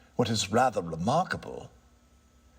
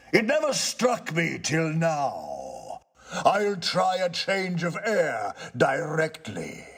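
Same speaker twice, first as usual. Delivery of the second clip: slow, higher, struck by the idea; a touch of ghost voice; 6 semitones higher, slower than usual. Acted. Ghost, surprised by the tenant's point, agrees slowly. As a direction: muse it as a dawning realisation.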